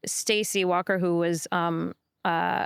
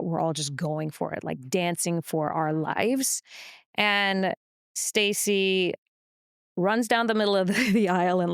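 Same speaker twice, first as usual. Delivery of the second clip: usual speaking voice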